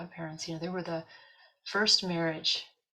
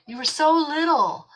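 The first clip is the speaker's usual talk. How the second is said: high, louder, slow cooing; tender baby talk; 10 semitones higher, 9 dB louder, slower than usual. Retold or imitated. Telling the dying cat about the day she came home, cooing. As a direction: coo it like to a tiny kitten.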